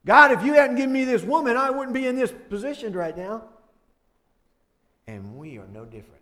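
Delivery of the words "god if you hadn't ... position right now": fast, loud, higher accusation; Adam blaming God. Retold or imitated. Adam accusing God over the woman, fast loud blame. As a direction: push the blame onto God. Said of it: "He's blaming God".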